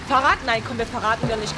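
normal talking voice

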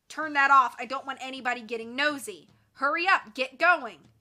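loud, impatient villain commands; barked orders. Acted. Villain orders Nancy to move on, loud and impatient.

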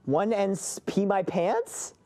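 slow question with rising end; drawn-out, questioning guess; puzzled guess. Pretend. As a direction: stretch it out, unsure, lifting the end. Guessing a whispered phrase.

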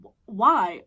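loud, startled why; shocked why. Retold or imitated. Reacting to the mother-in-law's cat news, loud disbelief. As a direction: blurt it out in disbelief.

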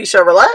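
"Chevrolet" said "shev-ro-LET"; spelling pronunciation. Shown as a demonstration. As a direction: sound the final T of "Chevrolet". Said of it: "it's supposed to be".

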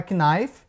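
"knife" as kee-NAIF; wrong sounded K. Shown as a demonstration. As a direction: mispronounce "knife" with the K pronounced. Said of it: "o K também é mudo". ("knife" errado, com K pronunciado)

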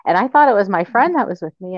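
normal talking voice

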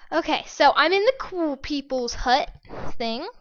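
ordinary talk, nothing marked

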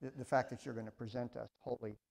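soft, hushed prayer voice; quiet prayer. Real, not acted. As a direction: pray quietly to God before the lesson. Pastor leads opening prayer.

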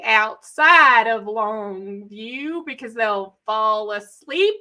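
very slow, drawn-out East Texas drawl; exaggerated Longview, East Texas drawl; East Texas accent. Shown as a demonstration. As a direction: stretch the vowels like a long-winded East Texan. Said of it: "that's the East Texas accent".

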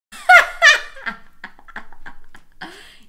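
long, high, loud laughter; real laughing fit. Real, not acted. After asking if they heard a fart, laughing fit. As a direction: crack up loudly and keep going.

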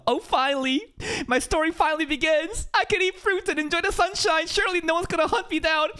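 high, giddy mock girl voice; imitating excited girl. Gamer mocking the spider girl's overjoyed speech. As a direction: gush it out like a naive heroine. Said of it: "Remember what she said".

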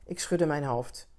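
ordinary delivery

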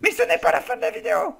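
shrieking in protest at a co-host ending the video; mock Chewbacca cry. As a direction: yell it like a Wookiee howl. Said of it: "le cri de Chewbacca".

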